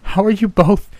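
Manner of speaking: loud, high-pitched disbelief; astonished shout. A narrator's reading. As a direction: cry out to family thought lost. Shouting back to lost wife.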